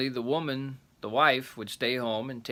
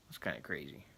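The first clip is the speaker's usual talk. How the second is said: ordinary delivery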